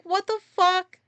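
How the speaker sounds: high, loud retold outburst of anger; pissed outburst. Vlogger reliving her anger at the event, loud and high. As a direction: relive the moment you got pissed. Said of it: "I was like".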